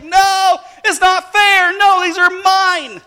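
imitating an upset girl, high and loud; mock childish tantrum; crying and mad. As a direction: wail in protest like a kid. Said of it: "she starts crying, and she starts getting mad".

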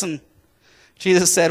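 normal talking voice

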